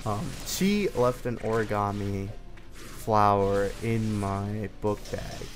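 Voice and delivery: normal talking voice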